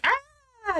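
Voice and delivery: high, soft imitated squeal of annoyance; cute mad squeal. She gets mad at him, cute high squeal. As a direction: squeal softly like someone adorably cross. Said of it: "she gets mad at him really cutely".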